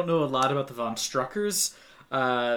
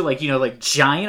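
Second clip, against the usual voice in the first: usual speaking voice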